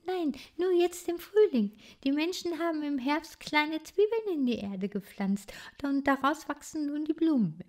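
put-on high, sing-song little bird girl; melodic bird voice. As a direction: explain it like a gentle melody. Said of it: "mit ihrer melodischen Stimme".

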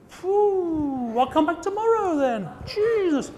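disgusted reaction. Retold or imitated. Speaker on a smelly stand, mock disgusted and high. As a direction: pull back from the stink, hammed-up. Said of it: "and going".

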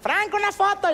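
very high squeaky request; funny high voice. Retold or imitated. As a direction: squeak it like a cartoon fan. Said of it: "alguien me saluda". (voz muy aguda de caricatura)

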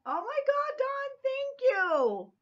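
high, slow, stretched squeal of delight; thrilled squeal. Real, not acted. Squealing thanks to Dawn for her gift. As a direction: squeal with joy over the gift.

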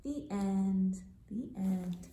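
soft whispered. Shown as a demonstration. Teacher whispering the end for kids to repeat. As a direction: model it gently. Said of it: "Can you say".

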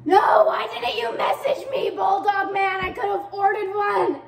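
high, loud complaint; exasperated yell. Real, not acted. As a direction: wail at the seller in frustration. Complains to seller about missing tuner.

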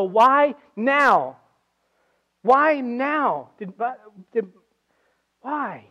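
high, rising plea to God, breaking into stammers; imitated frustrated cry. Preacher voices a bereaved believer questioning God, imitated frustrated cry. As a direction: act out baffled protest, loud then faltering. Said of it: "get frustrated with God or end up yelling at God".

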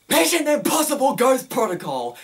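loud, high, bursting with energy; enthusiastic. Shown as a demonstration. Showing viewers his passionate review voice, loud and excited. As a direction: sell it like the best movie ever. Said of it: "I like to put a lot of passion".

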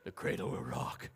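eerie whispered lullaby line; creepy whisper. Shown as a demonstration. Pastor to congregation, whispered creepy lullaby. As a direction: breathe it like a ghost story. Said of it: "just put a creepy voice to that whole thing".

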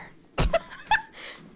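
high burst of laughter; laughing. Real, not acted. Breaks into laughter after whispering.

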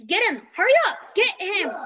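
frantic call to get him; urgent shout. Real, not acted. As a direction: yell at them to hurry and grab him. Urging officer to grab suspect.

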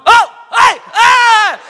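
high, loud shriek of fright; startled scream. Retold or imitated. Mom in prayer shrieking as a lizard crawls up her leg. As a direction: yelp as a lizard climbs your leg. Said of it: "My mom goes".

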